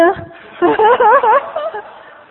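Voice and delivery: loud, high burst of laughter; big laugh. Real, not acted. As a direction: laugh out loud at your own punchline. Laughs after the punchline.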